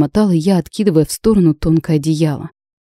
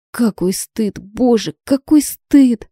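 mortified; 6 semitones higher, slower than usual. A narrator's reading. Mortified, face in hands.

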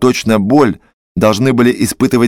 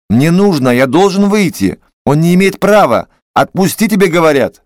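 pitched higher, pleading and insistent; frantic demand; 6 semitones higher than usual. Acted. A trembling doctor begs the orderly at the door, high and frantic. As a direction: push higher and higher, begging to be let out.

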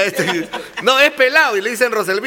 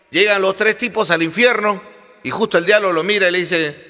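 ordinary delivery

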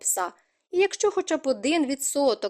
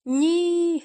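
higher, louder narrated cry; shouted; 7 semitones higher, 4 dB louder than usual. A narrator's reading. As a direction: cry it out in alarm, slightly restrained. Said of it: "закричав він". (стриманий вигук)

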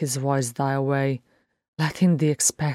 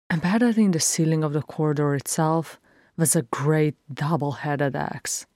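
ordinary delivery, nothing marked

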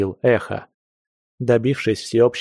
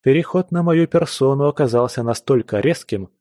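plain reading voice

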